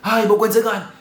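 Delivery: high-pitched alarmed cry; panicking. Retold or imitated. Storyteller voicing the family coming out of the house, panicking. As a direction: imitate panicked relatives rushing out. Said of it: "Everybody comes out the house panicking".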